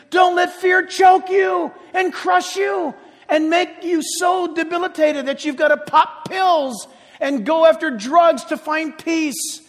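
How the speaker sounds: a hammed-up frantic plea, loud and slow; mock frantic preaching. Shown as a demonstration. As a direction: overdo the passion on purpose, crying out. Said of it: "with weeping and passion and jumping up and down".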